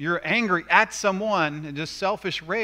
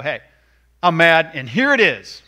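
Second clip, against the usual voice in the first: much louder mock blurt of anger; 12 dB louder than usual. Angry person unloading on someone, mock loud. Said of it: "He doesn't just go".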